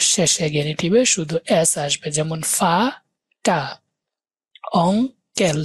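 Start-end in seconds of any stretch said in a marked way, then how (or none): 2.6-3.6 ফা টা split into two beats with a clear pause between; syllable by syllable. Shown as a demonstration. Teacher showing a two-syllable word, broken syllable by syllable. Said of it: "দুই syllable এগুলা দেখেন". 4.9-5.6 অং কেল broken in two, pausing after অং; syllable by syllable. Shown as a demonstration. Teacher showing two syllables to a student, word split apart. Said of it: "দুই syllable এগুলা দেখেন".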